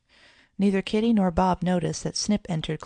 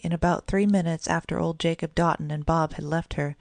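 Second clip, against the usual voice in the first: ordinary delivery, nothing marked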